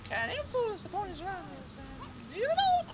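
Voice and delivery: extremely high, squeaky cartoon filly voice; shrill little pony squeak; squeaky character voice. Gamer voices Sweetie Belle's dialogue, extremely high squeaky voice. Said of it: "What sort of voice was that".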